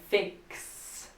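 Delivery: Fix with K then S audible at the end; both final consonants. Shown as a demonstration. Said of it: "Tem dois sons de consoante no final".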